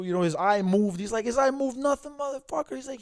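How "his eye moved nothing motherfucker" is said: high, mocking retort; furious friend mocking the referee; scoffing friend. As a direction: throw the excuse back with scorn. Friend scoffing at ref's excuse.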